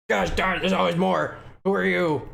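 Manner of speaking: high-pitched cartoonish character voice. Streamer trying out a game character, high character voice. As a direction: push the voice up high and exclaim.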